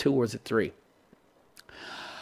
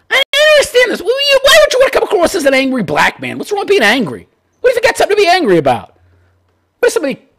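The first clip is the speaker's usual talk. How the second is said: exasperated rant; 3 dB louder, faster than usual. Real, not acted. Ranting, incredulous at caller.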